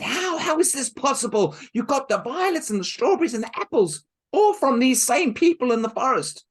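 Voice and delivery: high, loud amazed questions; astonished girl. Acted. Helen amazed at Marushka's fruit, high and loud. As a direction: gasp out the questions.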